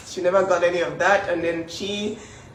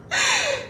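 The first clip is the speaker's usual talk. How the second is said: brief high burst of laughter; a quick laugh that breaks out mid-story; short amused laugh. Real, not acted.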